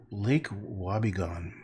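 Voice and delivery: ordinary delivery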